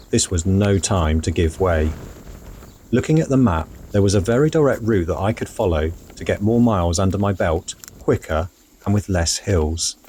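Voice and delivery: calm, measured travel voiceover; voiceover narration. A narrator's reading. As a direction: tell it like a reflective travel diary.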